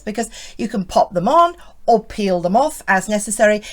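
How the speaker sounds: normal talking voice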